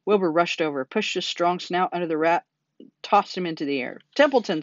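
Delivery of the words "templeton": high, loud call of a name; urgent scream. A narrator's reading. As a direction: yell to wake him up. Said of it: "screamed Wilbur".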